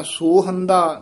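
ਸੋਹੰਦਾ with a long, full first vowel. Shown as a demonstration. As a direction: stretch the first syllable of ਸੋਹੰਦਾ. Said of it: "ਨਾ ਕਹਿਣਾ".

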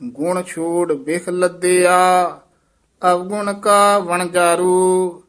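measured, sustained Gurbani recitation; scripture recitation. Shown as a demonstration. Teacher reciting verse for students, sustained recitation. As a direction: hold the line endings long and even.

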